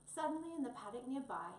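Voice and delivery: plain reading voice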